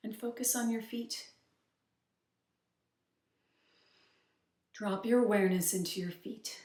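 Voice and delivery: soft, unhurried meditation voice with long pauses; softly led grounding exercise; guided meditation. Acted. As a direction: gently guide the listener's attention inward, leaving space between instructions.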